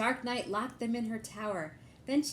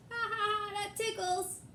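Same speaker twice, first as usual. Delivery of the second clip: much higher, chuckling dragon voice; dragon laughing; 11 semitones higher than usual. Acted. Dragon laughing at the joust, high chuckle. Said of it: "The dragon just laughed".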